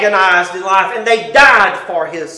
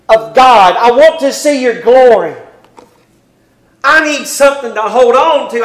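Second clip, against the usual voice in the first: high, loud pleading sermon voice; preacher building to a passionate plea; fervent preaching; 5 semitones higher, 6 dB louder than usual. Acted. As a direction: plead it out from the pulpit. Preaching at full force on Moses.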